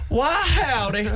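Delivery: high, drawn-out American cowboy greeting; exaggerated American accent, pitched high. Shown as a demonstration. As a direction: put on a slow, hammy American howdy. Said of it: "I'll do mine for you".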